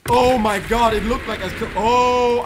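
excited shout. Real, not acted. Excited kill.

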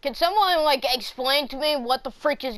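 loud, high outburst of confusion; baffled exasperation. Real, not acted. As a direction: plead for an explanation, exasperated. Baffled player demands an explanation.

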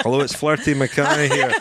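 mock Scottish voice for a pretend voice message; Scottish accent. Podcast host fakes a listener's message in a laughing Scottish accent. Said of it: "with that Scottish accent".

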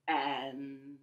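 usual speaking voice

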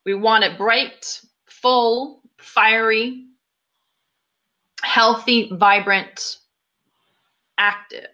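unhurried guiding voice with long pauses between phrases; guided meditation. Acted. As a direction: lead a group through breathing and visualization, leaving room after each phrase. Leads guided meditation after plain intro.

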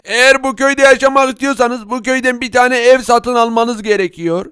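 ordinary talk

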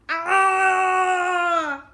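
long high scream; terrible scream. A narrator's reading. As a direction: scream in terror, drawn out. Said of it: "came the terrible screams".

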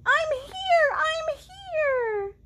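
high tiny chick voice; little chick voice. Acted. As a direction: speak as a newborn chick. Chick character in story.

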